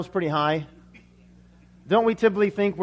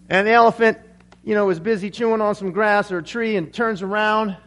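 ordinary delivery

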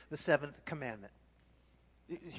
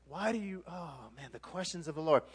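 usual speaking voice

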